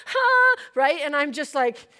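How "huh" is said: loud, high cry of overwhelm; hammed-up high sob; exasperated wail. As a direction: let out a loud frustrated wail. End of acted crying meltdown.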